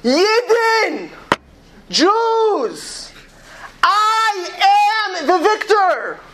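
high, long-drawn cry to a crowd; triumphant, slow high-pitched proclamation; defiant scream. Retold or imitated. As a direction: call it out very high, stretching every word. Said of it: "screamed at that moment".

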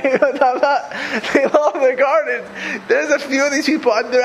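said through a laugh, drawn out; amused, stretched-out delivery. Real, not acted. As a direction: laugh while you say it slowly. Laughing repeat of gardens line.

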